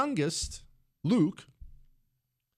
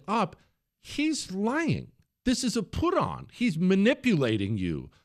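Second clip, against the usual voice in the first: high, urgent protest to the wife; retold protest; 8 semitones higher than usual. Telling his wife the boy's crying is an act, high and urgent. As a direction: insist he's faking, pitched way up.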